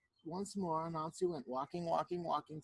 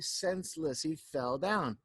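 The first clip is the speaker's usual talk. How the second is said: plain reading voice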